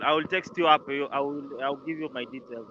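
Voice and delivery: ordinary delivery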